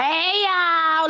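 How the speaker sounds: high, sloppy auntie greeting; drunk auntie voice. Retold or imitated. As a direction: sing it out like a tipsy aunt. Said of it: "like the drunk auntie".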